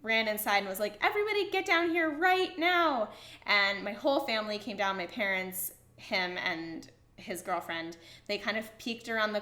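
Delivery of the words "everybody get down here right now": louder, higher mock call to everyone. Brother summoning the family to the porch, excited loud call.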